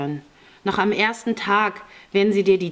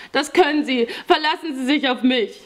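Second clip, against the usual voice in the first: high, earnest assurance; eager young boy; 8 semitones higher than usual. A narrator's reading. Boy assures Raisa he can be trusted, eager voice. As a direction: pipe up keenly like a grateful child.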